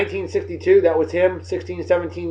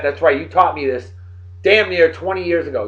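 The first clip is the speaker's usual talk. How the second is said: ordinary talk, nothing marked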